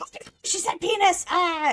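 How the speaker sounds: high, loud squeal of fake scandal; exaggerated horrified yelp; mock shocked outcry. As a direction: gasp and shriek like a scandalized prude. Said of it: "everybody is like".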